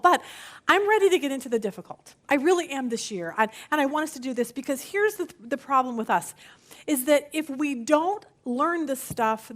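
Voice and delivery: normal talking voice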